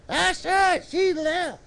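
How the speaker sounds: high puppet sidekick chiming in. Pretend. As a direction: agree in a high little puppet voice. Puppet Rowdy agrees with preacher.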